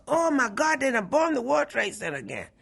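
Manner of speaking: loud, high cry of alarm; alarmed outcry. Retold or imitated. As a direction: shout it out in shock. Sees the tower on fire.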